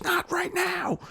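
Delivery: hammed-up irritated boss; imitating the manager. Manager snapping back at the worker, high mock voice. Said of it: "manager's retort".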